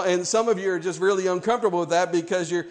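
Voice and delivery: ordinary talk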